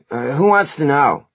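loud, high, drawn-out challenge; challenging bandmate Eric. A narrator's reading. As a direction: size up the stranger in a dude's voice. Plays Eric in the story.

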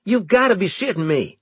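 high, fast disbelieving cry; can't believe it, blurt it; outburst. A narrator's reading. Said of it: "I burst out again suddenly".

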